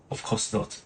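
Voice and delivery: breathy whispered aside, barely voiced; whispered hurt aside; sulky whisper. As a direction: mutter it under your breath like a sulking child. Reading a sulky character's line.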